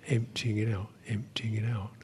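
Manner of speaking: whisper. Acted. Guided release, repeated softly.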